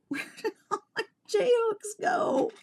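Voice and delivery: very high, laughing and crying at once; real tearful laugh, pitch flying up; laughing near tears. Real, not acted. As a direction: wail it through a laugh that breaks toward tears. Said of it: "like, shaking right now".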